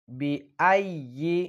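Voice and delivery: বিআইয়ি slowly with a firm, doubled ইয়ি. Shown as a demonstration. Teacher showing the firm ইয়া. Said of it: "সবসময় শক্ত করে উচ্চারিত করবেন".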